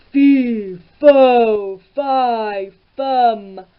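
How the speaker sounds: huge booming ogre roar. A narrator's reading. Hungry ogre sniffs out Jack, booming roar. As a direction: roar each syllable like a giant. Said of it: "sniffing round the kitchen roared".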